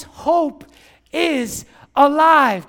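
loud, drawn-out declaration to a crowd; slow shout, punching each word; rally the congregation with force; rallying proclamation. Real, not acted. Preacher rallies congregation to shout back.

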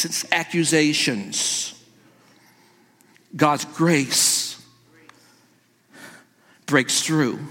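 slow, low and softened, with long pauses; solemn hush. Real, not acted. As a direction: drop right down and let each phrase land gravely. Preacher slows solemnly for grace.

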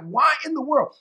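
high, baffled exclamation; acted-out bewilderment; confused. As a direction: ask it in exasperated bafflement. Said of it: "he was confused".

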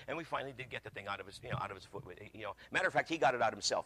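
ordinary delivery

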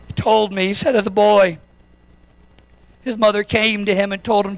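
normal talking voice

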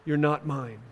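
subdued, solemn retelling of angry words; retold shout, hushed. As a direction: voice the shout quietly, as grieving love. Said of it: "as he shouts".

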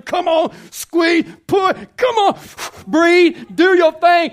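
high, loud delivery-room coaching shout; yelling. Acted. Doctor to a mother in labour, yelling. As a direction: yell it like a doctor urging a push. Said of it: "a doctor's saying push and yelling".

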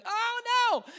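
high, mock-dismayed wail; dismayed crowd. Convicted listeners realizing what they missed, high-pitched dismay. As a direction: act out a stricken listener's dawning dread. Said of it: "They feel something in them going".

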